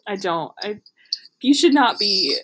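usual speaking voice

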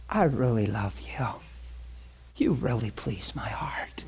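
hushed tender divine words; a hint of a quiet loving God; God's voice. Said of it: "he's saying stuff like".

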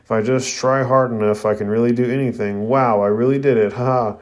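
plain reading voice

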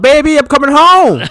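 high, loud, overdone lovey cry; hammy sweet call. Retold or imitated. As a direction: ham up a lovesick husband. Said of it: "quoting Triple H".

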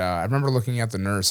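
usual speaking voice

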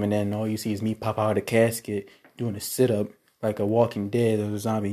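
ordinary talk, nothing marked

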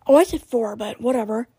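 ordinary talk, nothing marked

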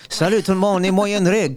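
put-on a playful Acadian accent. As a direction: greet the crowd like a young Acadian band. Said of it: "Il voulait juste faire son accent acadien".

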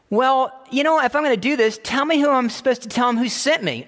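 a reluctant Moses pushing back at God; anxious Moses. Acted.

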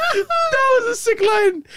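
high, drawn-out praise through laughter; helpless, high-pitched laughing; laughing hard. Real, not acted. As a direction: squeeze the words out mid-laugh. Laughing at co-host's line.

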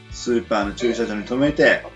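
ordinary talk, nothing marked

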